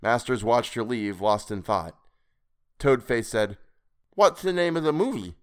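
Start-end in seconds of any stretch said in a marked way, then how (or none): 4.1-5.4 creepy high voice. Acted. Creepy stranger character voice.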